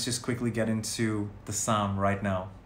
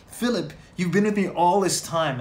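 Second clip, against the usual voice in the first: raised, louder pleading Jesus; 6 semitones higher, 4 dB louder than usual. Acted. Jesus pleading with Philip, lightly voiced and raised. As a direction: plead with him like a gently hurt friend. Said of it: "I imagine Jesus talking like that".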